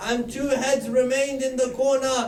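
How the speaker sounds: keen the story to the mourners; majlis recitation. Acted. Preacher grieving before the gathering, mournful recitation.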